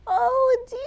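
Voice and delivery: high, loud horrified cry. Acted. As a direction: wail it out in horror. Edward's horror.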